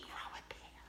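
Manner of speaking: voiceless and very soft; cringing whisper. Real, not acted. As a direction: hide the words in a breath. Cringing at her own words.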